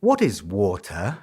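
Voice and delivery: slow, low, lightly imitated puzzled housekeeper; a hint of a bewildered maid. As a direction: mimic her confused question slowly. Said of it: "She couldn't understand my accent".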